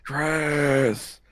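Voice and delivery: loud repeated calling of a name. Real, not acted. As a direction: call into the void for him. Calling out to silent friend.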